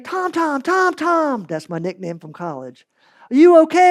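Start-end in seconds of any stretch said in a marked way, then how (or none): 0.0-1.4 high, panicked calling of a name; frantic calling. Retold or imitated. Friend calling to a fallen buddy in panic. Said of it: "He's like". 3.3-3.9 high, loud panicked question; worried shout. Retold or imitated. Friend shouting to a fallen buddy, alarmed. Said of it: "He's like".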